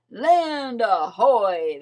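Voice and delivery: loud, slow call from the crow's nest; loud, drawn-out lookout call. A narrator's reading. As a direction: call it across the sea. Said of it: "they called".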